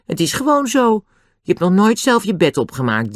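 high-pitched indignant insistence. A narrator's reading. Annie justifies herself to Dick, indignant. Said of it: "zei Annie verontwaardigd".

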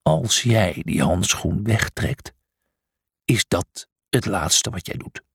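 low, slow, deliberate warning; menacing threat. A narrator's reading. Miles with spear aimed at Brutus, threatening. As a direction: threaten slowly, dropping the pitch.

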